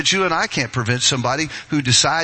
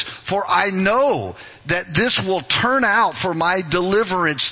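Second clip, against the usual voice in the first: scripture reading; 8 semitones higher than usual. Real, not acted. Pastor reads Paul's letter aloud to the church, preaching. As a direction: proclaim the verses to the church.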